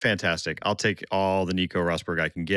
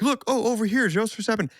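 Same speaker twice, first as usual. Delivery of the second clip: mock eager reporter; 13 semitones higher than usual. Crews chasing celebrities with umbrellas.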